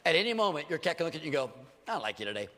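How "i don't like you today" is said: low, quiet imitation of a cat; lightly acted cat, dropped voice; voiced cat. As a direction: play a cool cat, softly and low. Voices a cat turning on owner.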